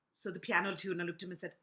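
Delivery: ordinary delivery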